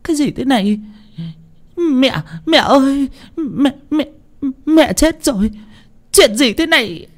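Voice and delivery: very high, stammering cries; shocked shrieking. A narrator's reading. Wife confronting her husband over the death, shrieking. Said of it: "Mụ hét lên".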